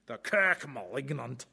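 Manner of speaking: a hint of Scottish, kirk rolled; lightly put-on Scottish burr on kirk; Scottish accent. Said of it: "just crying out for a Scottish accent".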